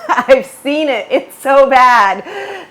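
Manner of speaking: loud, drawn-out laugh through the words; high, loud and helpless with amusement. Real, not acted. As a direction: crack up while exclaiming, stretching it out. Laughing over bad AI monologues.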